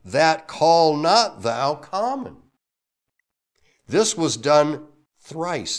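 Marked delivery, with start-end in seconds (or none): 0.0-2.4 slow, loud and weighty; voice of God, solemn. Acted. God correcting Peter, slow and solemn. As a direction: lay down each word deliberately. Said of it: "the voice spake unto him again".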